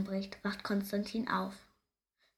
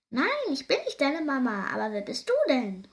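lightly voiced squirrel, louder, starting high; curious squirrel; 4 semitones higher, 5 dB louder than usual. A narrator's reading. Squirrel questioning a strange baby hare, lightly voiced curiosity. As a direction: answer with a touch of curiosity. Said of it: "fragt es und springt neugierig".

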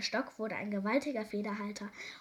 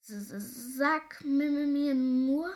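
slow, high stammer swelling louder; alarmed king struggling to get words out; frightened stutter; 4 semitones higher, 6 dB louder, slower than usual. A narrator's reading. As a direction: stutter in fright, dragging out the sounds. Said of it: "stotterte der König erschrocken".

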